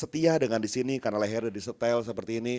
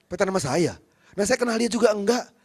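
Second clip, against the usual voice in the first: incredulous surprise; 8 semitones higher, 6 dB louder than usual. Retold or imitated. Pastor hears he is blamed.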